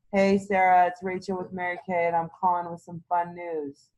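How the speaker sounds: a lightly voiced bored caller with no enthusiasm; flat, bored phone voice. Shown as a demonstration. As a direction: read it like a chore. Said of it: "there's no fun excitement in that voice".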